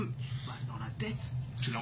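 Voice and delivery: hushed, breathy threat; menacing whisper. Acted. Captor whispers the murder detail to victim. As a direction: whisper it like a secret.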